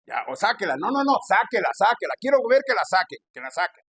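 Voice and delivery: loud, high, repeated demand; imitated pushy pastor. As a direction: mimic a pastor badgering the congregation. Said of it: "con ese acento y ese tono de voz".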